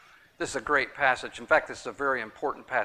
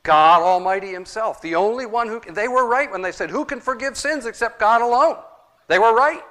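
full-force preaching; 10 semitones higher, 11 dB louder than usual. Acted. Preacher proclaiming Jesus is God, full-force preaching.

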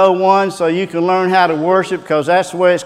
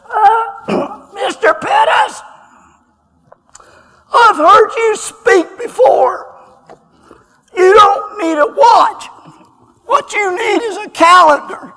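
much higher, buzzing robotic voice; the old deacon speaking through a throat device, raspy and electronic; 4 dB louder than usual. Retold or imitated. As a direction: imitate an electrolarynx voice, slow and mechanical. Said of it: "put his finger over his throat to speak".

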